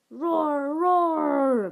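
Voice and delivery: loud, hammed-up child's roar; playful roar. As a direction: growl like a pretend dinosaur. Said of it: "growled Little Bill".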